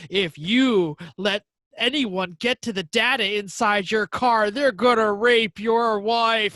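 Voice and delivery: slow, loud scare-mongering; mock alarmist. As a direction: shout the scare claim. Mocking industry scare claim.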